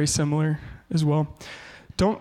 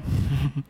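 short laugh; 4 semitones lower than usual. Real, not acted. Laughing at his own failure.

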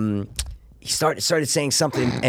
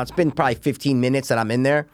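ordinary talk, nothing marked

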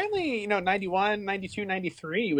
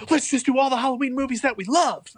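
mock excited quote; 11 semitones higher than usual. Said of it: "was like".

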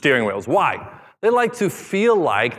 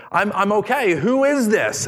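crying out for help; 8 semitones higher than usual. A narrator's reading. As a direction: call up from the branch, desperate. Jack answering the voice.

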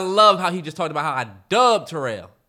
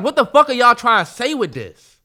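loud, higher mock outrage; retold outrage; 7 semitones higher, 7 dB louder than usual. Retelling the fans' table, voiced heated and loud. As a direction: demand what they meant, heated. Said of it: "And we was like".

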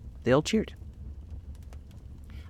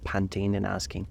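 usual speaking voice

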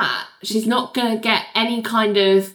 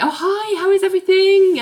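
much higher, gushing fake friendliness; overly nice greeting; 12 semitones higher, 3 dB louder than usual. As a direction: chirp it up high like you're trying too hard. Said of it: "being really nice to her".

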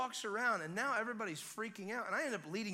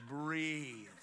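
stern command; 4 semitones lower than usual. Retold or imitated. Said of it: "I went from nice Neo to".

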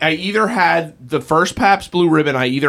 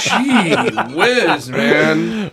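laughing; 5 semitones higher, much slower than usual. Real, not acted. Laughing at red tide joke.

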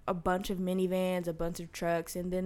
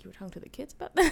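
rattled off in quick disbelief, higher; fast disbelief; 7 semitones higher, 3 dB louder, much faster than usual. Retold or imitated. Inner reaction to the grandmother's story, quick stunned disbelief. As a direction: blurt the question in shock.

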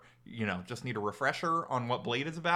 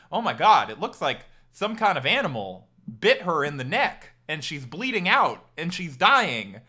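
louder, hammed-up alarm, slowing on "dying"; mock panicked doctors; 2 semitones higher, 7 dB louder than usual. Mocking the incompetent movie doctors with a loud, panicky voice. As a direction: play the clueless doctors in fake panic. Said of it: "they're like".

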